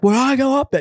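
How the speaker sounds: high, loud mock shout; imitating a yell. Skydive instructor yelling at the nervous narrator, high and loud. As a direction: yell it over the wind like an Aussie instructor. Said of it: "super loud".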